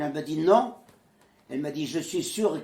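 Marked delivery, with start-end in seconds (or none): 0.4-0.8 a sharp, loud, high refusal; firm no. Retold or imitated. The woman forbidding the boy to leave for Villeurbanne, a sharp loud no. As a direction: cut him off with one loud word.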